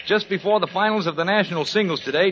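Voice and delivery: normal talking voice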